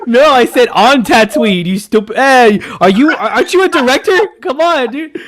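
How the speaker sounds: high, laughing teasing complaint; mock exasperation. Real, not acted. Host mockingly scolding his friend for a flat line reading.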